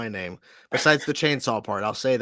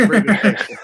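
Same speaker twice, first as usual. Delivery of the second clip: laughing; 7 semitones higher, 7 dB louder than usual. Real, not acted. Host laughing at guest's joke.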